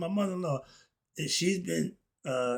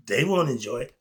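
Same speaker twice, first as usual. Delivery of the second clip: ordinary delivery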